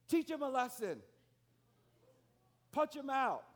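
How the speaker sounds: high, loud yell from the window; mother yelling. Retold or imitated. Neighbor's mother shouting at the boys, high loud yell. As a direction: scream it at the kids like an angry mother. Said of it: "She said".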